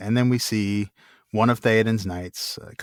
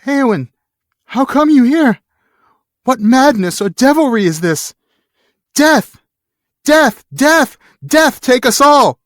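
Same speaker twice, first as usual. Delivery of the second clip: much higher and louder, raging scream, slow at the end; fey furious howl; 11 dB louder than usual. Acted. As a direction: scream in mad grief and fury. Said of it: "A fey mood took him".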